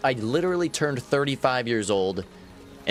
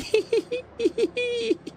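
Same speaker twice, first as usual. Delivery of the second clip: squeaky high-pitched giggle; high tickled giggling fit. Pretend. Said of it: "lying there going".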